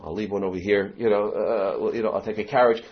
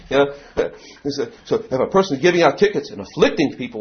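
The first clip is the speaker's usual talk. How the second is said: ordinary talk, nothing marked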